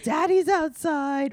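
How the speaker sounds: high, slow sing-out; mock daddy voice. Him announcing himself to the husky, mock cooing call.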